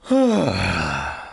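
heavy, falling exhale; long sigh. Real, not acted. Reader interrupted by a phone call, long sigh. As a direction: sigh it out at length.

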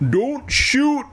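high-pitched pleading yell; crying out. A narrator's reading. Evan running from armed police, high cry. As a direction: yell it high as you flee. Fleeing boy cries out to police.

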